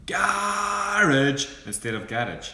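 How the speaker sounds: "garage" said long and high, stressed on GA then the plain "garage"; contrasting "garage" loudly, drawn out. Shown as a demonstration. Host to co-host, mocking "garage" in a loud, slow, high voice. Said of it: "You say".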